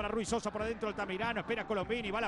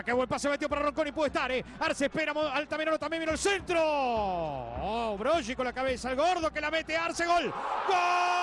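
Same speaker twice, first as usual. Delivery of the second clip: breakneck goal commentary; 7 semitones higher, 5 dB louder than usual. Real, not acted. Goal scored, commentator shouting.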